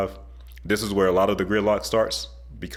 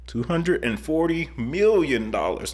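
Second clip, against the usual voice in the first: slow, higher echo of the price; disbelief; 7 semitones higher, slower than usual. Real, not acted. Echoing the reporter's project cost, slow stunned disbelief. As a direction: repeat the number slowly, stunned.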